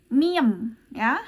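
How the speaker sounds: miem said with a clear M sound; pronounced M. Shown as a demonstration. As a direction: say miem with a firm M, not B or P. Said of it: "tapi dibacanya".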